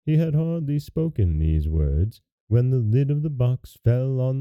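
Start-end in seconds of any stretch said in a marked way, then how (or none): none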